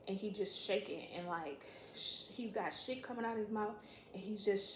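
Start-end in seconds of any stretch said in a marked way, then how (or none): none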